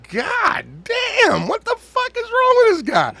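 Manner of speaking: high-pitched, incredulous outburst; shocked disbelief. Retold or imitated. Co-host retelling his reaction to the footage, shocked disbelief.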